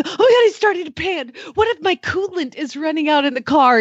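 a touch of mock alarm; retold panic. Owner fears for her dog in the hot truck, mild mock panic. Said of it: "I was like".

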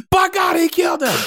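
high shouted announcer call; wrestling announcer. Pretend. Podcast host mocking hot take, shouting like a wrestling announcer. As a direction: scream it like a ringside commentator.

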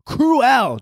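"cruel" broken into "croo-EL", high-pitched; over-enunciated. Real, not acted. Tongue-tied podcaster retrying "cruel" as high "croo-EL". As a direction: force "cruel" out in two exaggerated syllables. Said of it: "Why the fuck can't I say that with my tongue".

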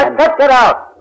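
high, loud yell to get out; furious shout. Acted. Husband yelling at the detectives to leave, furious. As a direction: bark the order at full volume.

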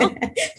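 high squeaky giggle; laughing at own crack; embarrassed laugh. Real, not acted.

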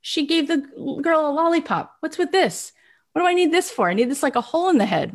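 put-on high whiny kid voice; imitating a complaining child; child self. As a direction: whine at mom like a little child. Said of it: "I remember saying to her".

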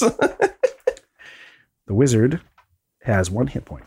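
very slow, drawn-out words; suspenseful reveal. Real, not acted. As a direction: stretch it out to build suspense. Suspenseful reveal of hit points.

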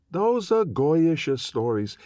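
put-on high, slow old grandmother. A narrator's reading. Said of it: "he hears his grandmother say".

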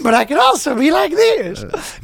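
high falsetto, half laughing; high silly voice. Acted. Showing off his range in a laughing high voice. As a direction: squeak it up while cracking up. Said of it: "so many different voices".

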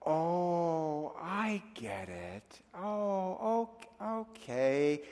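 soft, drawn-out realization; murmured, slow "now I understand"; dawning realization. Retold or imitated. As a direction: let it slowly sink in, quietly. Retold private realization after a phone call.

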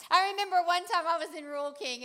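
normal talking voice

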